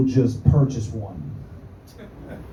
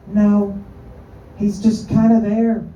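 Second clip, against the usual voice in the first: put-on a child shrugging it off; kid voice; 7 semitones higher, slower than usual. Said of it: "I gotta work on my fucking kid voice".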